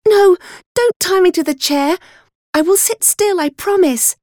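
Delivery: high, pleading cry. A narrator's reading. Girl begs not to be tied, crying out. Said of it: "but I cried out".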